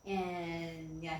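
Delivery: ordinary delivery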